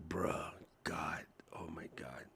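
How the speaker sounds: whispered, fed-up oh my God; whispered exasperation. Retold or imitated. Fed up with the AI ad, whispered groan. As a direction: breathe it out in disbelief. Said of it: "And I'm like".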